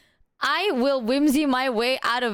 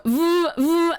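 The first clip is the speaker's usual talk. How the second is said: phone buzz imitation; 6 semitones higher, 5 dB louder than usual. Retelling her phone buzzing, imitates the buzz. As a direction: mimic a vibrating phone's buzz, louder. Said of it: "my phone went voo".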